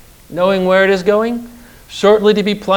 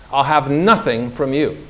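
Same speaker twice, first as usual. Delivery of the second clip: ordinary delivery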